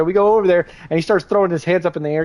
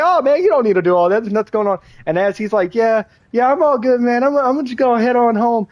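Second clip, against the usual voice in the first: high-pitched, nervous denial; high evasive junkie voice; 7 semitones higher than usual. Retold or imitated. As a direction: play it innocent and jittery, voice up high. Craig voiced brushing off search.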